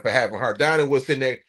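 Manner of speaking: usual speaking voice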